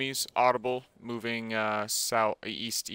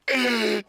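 high laugh; 10 semitones higher than usual. Real, not acted. Laughing at grenade joke.

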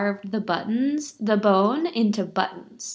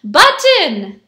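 loud, raised-pitch call; cried command; 6 semitones higher, 11 dB louder than usual. A narrator's reading. Beggar demanding the button, loud cry. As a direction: announce the ingredient with a shout. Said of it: "he cried".

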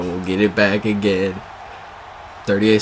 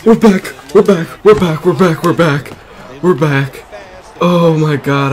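elated relief; 7 semitones higher, 8 dB louder than usual. Real, not acted. Streamer gets his game back after lagging out, loud elated relief. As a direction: shout it over and over, starting high and settling.

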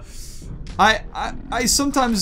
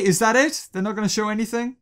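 ordinary delivery

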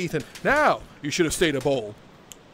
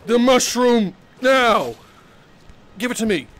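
loud demand; 8 semitones higher, 9 dB louder than usual. Acted. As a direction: play a wizard demanding his prize. Playfully threatening a player, demanding.

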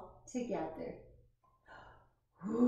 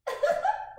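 high squeaky burst of giggling; a child's sudden giggle; 3 dB louder than usual. Acted. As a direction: giggle out loud at a silly sight. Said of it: "when I giggle and laugh".

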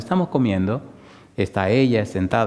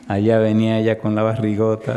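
ordinary talk, nothing marked

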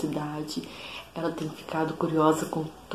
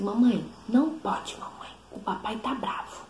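put-on a little girl copying mom's warning, dropping to a whisper; toddler imitating mom; 4 semitones higher than usual. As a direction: daughter copies her mother's warning, mock child scolding then whisper. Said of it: "me imitando".

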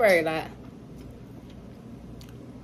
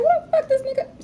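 shocked outburst; 5 dB louder than usual. Retold or imitated. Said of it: "I'm like".

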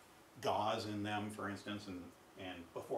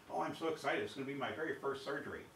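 higher, eager imitation of a keen rookie surgeon; excited young newcomer; 5 semitones higher, 3 dB louder than usual. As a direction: gush it like a mock-thrilled beginner. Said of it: "new guy, and he's like".

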